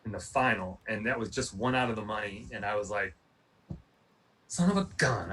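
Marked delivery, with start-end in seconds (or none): 4.5-5.3 mild frustrated exclamation. Retold or imitated. Missing prize money by one.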